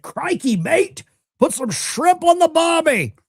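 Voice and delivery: hammed-up Australian accent, pitched high. Streamer reads out a chat comment, in a mock Australian accent. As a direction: put on a loud-and-proud, high-pitched Aussie accent. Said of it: "That's my best Australian accent".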